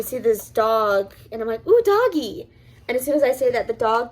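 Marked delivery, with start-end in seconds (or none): 1.7-2.5 high-pitched retold squeal of delight; excited cooing. Storyteller spotting a loose dog, excited cooing. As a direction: coo at a dog you just spotted.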